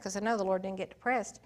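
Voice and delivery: ordinary talk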